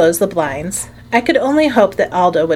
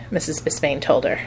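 plain reading voice

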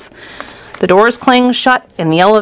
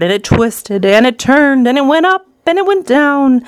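building excitement; 6 semitones higher than usual. A narrator's reading. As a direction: pitch climbing with each phrase. Narrator builds up the hurtling ride.

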